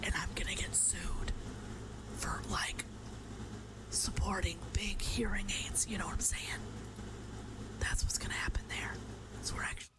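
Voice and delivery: soft whispering throughout; mock ASMR demo, soft whisper. Shown as a demonstration. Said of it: "Sorry about the A S M R".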